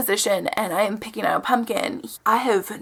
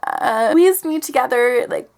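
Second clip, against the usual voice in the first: ordinary talk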